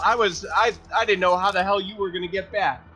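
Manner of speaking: normal talking voice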